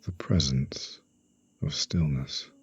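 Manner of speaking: slow meditation voice with long pauses; guided sleep hypnosis. Acted. Hypnotherapist guiding a listener to relax for sleep, slow and soothing. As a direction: ease the listener into stillness, phrase by phrase.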